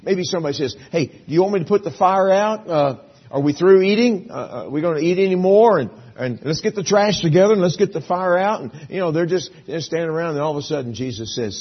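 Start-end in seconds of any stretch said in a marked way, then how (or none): 0.9-8.6 calling out to the group. Acted. Disciple calling out at fire.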